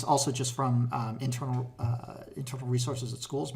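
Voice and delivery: usual speaking voice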